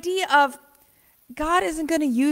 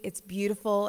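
normal talking voice

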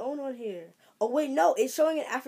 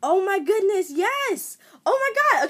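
high, loud squeal of real delight; excited shriek; 11 semitones higher, 12 dB louder than usual. Real, not acted. Opening her DNA results, a loud excited shriek. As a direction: she can't hold the joy in.

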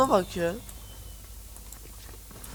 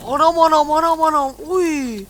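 loud high panicked cries of mom; high frantic yelling; 6 semitones higher, 8 dB louder than usual. Real, not acted. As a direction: shriek it in panic. Gamer shrieks at danger.

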